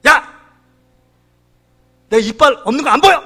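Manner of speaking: acted-out scolding with a sharp opening shout; sudden angry yell. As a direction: burst out indignantly, as a retold complaint. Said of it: "소리를 버럭 지르는 거예요".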